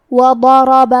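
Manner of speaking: وضرب with all four fathas equal length; even fathas. Shown as a demonstration. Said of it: "چاروں زبر کو برابر سے". (وضرب کے چاروں زبر برابر)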